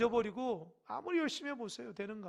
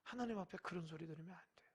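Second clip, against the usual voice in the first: fast, whispered warning; urgent whisper; 3 semitones lower, 9 dB quieter, faster than usual. Acted. Preacher warning the congregation, fast and whispered. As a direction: hiss the warning quickly under the breath.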